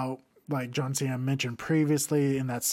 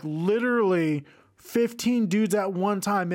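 normal talking voice

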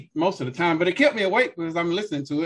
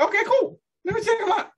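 retold agreeable reply; faster than usual. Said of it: "You know, I'm like".

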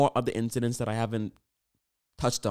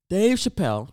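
announcer intro; 7 semitones higher, 7 dB louder than usual. Acted. Redo of the announcement.

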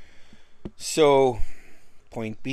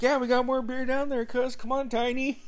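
much higher, laughing as he waves them on; laughing invitation; 12 semitones higher than usual. Retold or imitated. Friend inviting cousin Tiny to more beer, higher laughing invite. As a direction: pull him along, laughing and eager.

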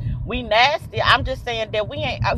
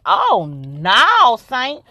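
mock horror; 3 semitones higher, 8 dB louder, much slower than usual. Reaction to twelve hundred hold.

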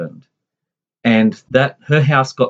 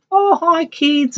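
put-on much higher, sing-song Newtown accent greeting; high cheery Newtown accent imitation; much slower than usual. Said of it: "she talked with the Newtown accent".

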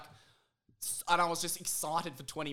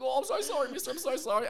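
put-on high, louder kid voice apologising; scared kid; 10 semitones higher, 5 dB louder than usual. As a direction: plead sorry like a frightened boy.

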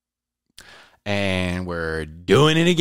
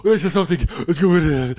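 high, louder, frightened asking; imitating his own scared voice; panicked question; 8 semitones higher, 6 dB louder, faster than usual. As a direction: blurt it out in a panic. Retelling his panic at night.

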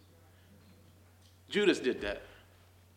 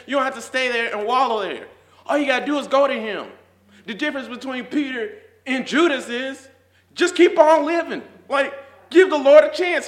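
higher, louder, rising to a joyful shout; full-force preaching; 10 semitones higher, 12 dB louder than usual. Acted. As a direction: preach it to the back row, climbing.

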